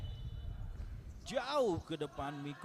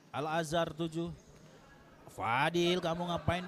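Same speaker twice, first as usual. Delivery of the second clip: usual speaking voice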